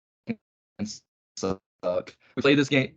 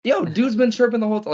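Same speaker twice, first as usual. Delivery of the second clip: high, louder complaint to the ref; protesting to the ref, pitched up; retold protest; 12 semitones higher, 4 dB louder than usual. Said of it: "I was like".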